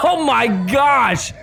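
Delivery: breaks into laughter on a high oh my gosh; laughing it out, drawn out slowly. Real, not acted. Reacting to a violent kick.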